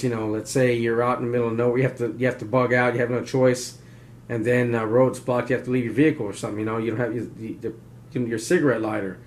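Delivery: ordinary talk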